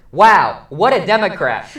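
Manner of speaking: loud mock-sarcastic wow; sarcastic title card. Film critic reading a sarcastic title card aloud, loud and mocking. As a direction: read the card with big fake admiration. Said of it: "it just says".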